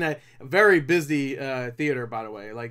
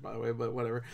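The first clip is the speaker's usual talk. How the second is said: ordinary delivery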